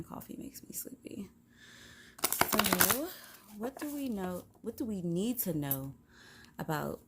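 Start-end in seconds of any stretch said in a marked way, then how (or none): 0.0-1.3 whisper. Real, not acted. Aside about coffee.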